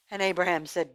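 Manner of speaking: usual speaking voice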